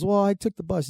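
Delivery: high-pitched mock excuse; crackhead impression. Crackhead answers dad about his car, high meek impression. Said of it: "He goes".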